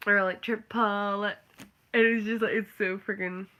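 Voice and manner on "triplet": "triplet" slowly stretched to TRIP-uh-let; three-syllable triplet. Shown as a demonstration. Countering with the musician's version, three-syllable triplet. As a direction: drag "triplet" out into three beats. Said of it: "to a musician, it's triplet".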